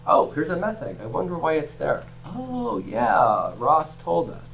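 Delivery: lightly voiced puzzled reader, soft; musing student. Teacher voicing a student who spots a metheg, lightly musing. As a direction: think aloud softly, then a high, drawn "Oh" of recall.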